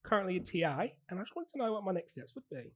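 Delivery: casual put-on Aussie accent; Australian accent. Teaching assistant asking recruiter about fostering, Australian accent. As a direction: play an Australian making an enquiry. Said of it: "I'm actually from Australia".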